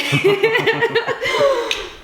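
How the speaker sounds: high, long giggle; laughing. Real, not acted. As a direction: burst into high-pitched giggles at your own joke. Laughs at own farty joke.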